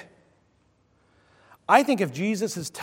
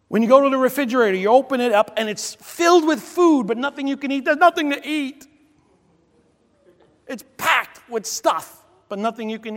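mock complaint; 9 semitones higher, 5 dB louder than usual. Mocking fridge full but nothing.

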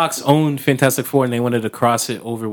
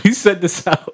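ordinary talk

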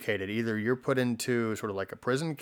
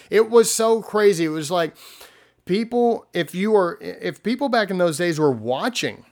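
excited; 7 semitones higher, 5 dB louder than usual. Real, not acted. Marvelling at the Berlin Wall.

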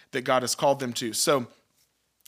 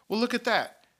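high, delighted well, look at that; a sudden lift of wonder; surprised; 9 semitones higher than usual. Real, not acted. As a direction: marvel at the verse aloud. Reacting to the verse.

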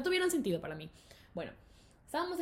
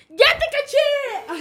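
high, loud, drawn-out accusation; acted-out teacher pouncing on a cheater; triumphant mock shout; 14 dB louder, much slower than usual. Teacher catching the student cheating, loud mock shout.

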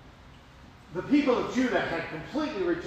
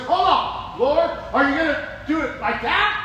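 loud, high disbelief; whoa, hold on there; incredulous raised voice; alarmed objection; 7 semitones higher, 7 dB louder than usual. Retold or imitated. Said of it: "He says".

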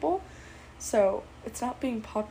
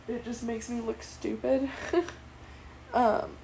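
ordinary talk, nothing marked

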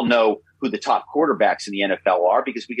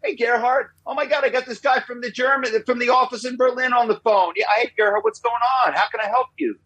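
high-pitched, excited helpful office worker; eager colleague answering; 13 semitones higher than usual. Acted. Office worker answering a call from Berlin, eager and high-pitched. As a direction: greet the caller eagerly, voice pitched way up. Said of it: "the person would answer the phone and go".

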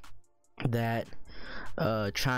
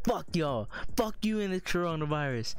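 frat bro; 7 semitones higher than usual. Retold or imitated. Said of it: "walk out the door".

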